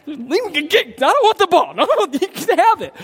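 high, mock-panicked surrender; hammed-up scared player giving in; intimidated player. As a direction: back off comically in a high voice. Mimicking a player giving up the ball.

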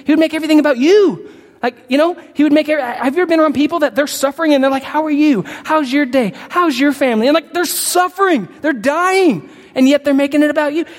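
high, loud preaching cadence; impassioned pulpit build; fervent preaching. Real, not acted. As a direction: preach it with rising fire. Preaching at full force.

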